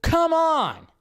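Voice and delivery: ordinary delivery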